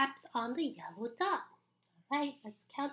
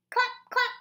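higher, louder clap clap; loud clap calls; 10 semitones higher, 7 dB louder than usual. Acted. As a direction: call it out bigger. Clapping grows louder.

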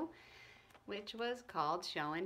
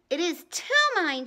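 loud high shriek of frustration; 7 semitones higher, 11 dB louder than usual. Acted. Ramona shrieks at Howie over the ribbon, furious. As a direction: shriek it, losing all patience. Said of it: "she shrieked".

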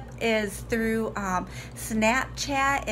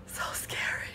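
whisper; 10 dB quieter than usual. Real, not acted. Whispering in creepy elevator.

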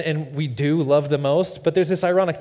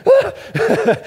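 high loud startled cry; sudden shriek of a dad woken up; startled yelp; 9 dB louder than usual. Retold or imitated. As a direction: jolt awake with a yelp. Startled awake at night.

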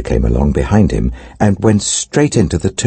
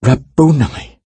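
cried out; 10 semitones higher, slower than usual. A narrator's reading. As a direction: call out the name in sudden joy. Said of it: "cried out in Aramaic".

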